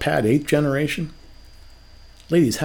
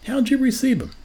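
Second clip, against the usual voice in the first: "How did you receive 'em?" asked much higher, leaning on you; pointed higher repeat; repeated higher for emphasis; 10 semitones higher, faster than usual. Shown as a demonstration. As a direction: press the question home, pitched up. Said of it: "How did you receive 'em?".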